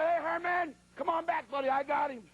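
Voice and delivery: high, carrying holler; calling out. Retold or imitated. Hunter to fled guide after the kill, calling out.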